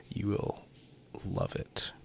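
soft unvoiced whisper as a joke; whispered aside. Host whispering a joke to listeners, hushed. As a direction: breathe it like a secret.

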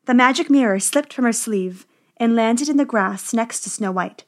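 ordinary delivery, nothing marked

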